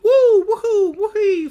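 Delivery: high-pitched cartoon whooping; Mario whoops. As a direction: imitate Mario's flying hoots. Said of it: "Mario in a long-standing discussion format".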